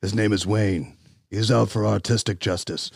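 low, soft mock trailer narrator. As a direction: announce it like a cheesy trailer.

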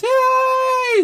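high, squealed token hooray; mock cheer. Fan congratulating the coach he didn't want, mock cheering. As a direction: put on a high little celebration.

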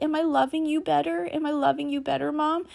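put-on high, hopeful child's voice asking; a small boy checking if he's doing it right; eager little son. Mother imitating her youngest son asking if he loves her better, in a high, eager child voice. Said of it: "He's like".

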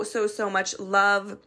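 ordinary talk, nothing marked

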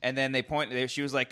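usual speaking voice

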